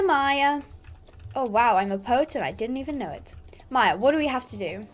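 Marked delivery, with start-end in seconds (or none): none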